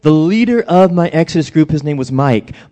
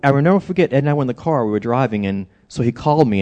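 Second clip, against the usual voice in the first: normal talking voice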